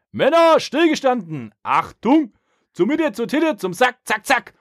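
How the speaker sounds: hoch gebrüllte Kasernenhofbefehle; gebellte Drillkommandos. Retold or imitated. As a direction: wie ein Feldwebel brüllen. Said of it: "schrie Chavez in der Umkleide". (gebellte Drillkommandos)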